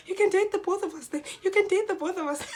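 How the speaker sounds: high, loud begging; loud high plea to boyfriend; pleading. Retold or imitated. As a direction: plead with him to stay. First telling of plea.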